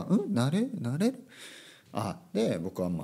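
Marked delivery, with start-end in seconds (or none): 0.1-1.2 higher, softer questioning echo; puzzled student. Retold or imitated. Student hearing an unknown word from the teacher, puzzled. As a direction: imitate a learner puzzling over a word. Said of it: "って言ったら".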